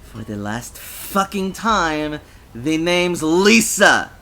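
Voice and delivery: slow, loud, emphatic anger; furious. A narrator's reading. Lisa correcting the drunk on her name, furious. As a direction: spell it out slowly, rising to a loud finish. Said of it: "She was furious".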